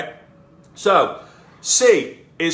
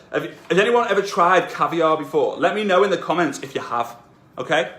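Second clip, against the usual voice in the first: usual speaking voice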